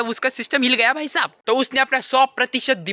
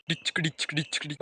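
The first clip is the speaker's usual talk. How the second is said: imitated beat; 7 semitones lower, 11 dB quieter than usual.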